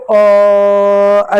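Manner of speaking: अ held long on a level tone; drawn-out अ. Shown as a demonstration. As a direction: sustain अ as one long vowel. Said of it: "लंबा उच्चारण कीजिएगा". (अ का लंबा उच्चारण)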